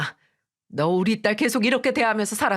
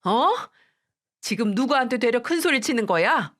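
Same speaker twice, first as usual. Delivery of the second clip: higher, outraged dressing-down; mother berating her son-in-law, outraged; furious scolding; 4 semitones higher than usual. Acted.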